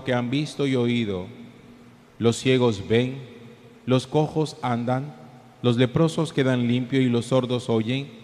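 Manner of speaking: solemn gospel reading, echoing; cathedral echo. Real, not acted.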